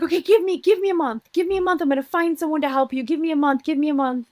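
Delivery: imitating a mother's urgent plea, high and insistent; high retold motherly urging; pleading mother.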